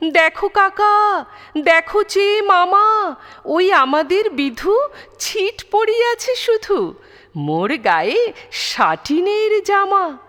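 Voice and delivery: high-pitched, gleeful calling out; boy crowing over his new finery; proud boasting. A narrator's reading. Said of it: "বুক ফুলাইয়া চলে, সবারে ডাকিয়া বলে".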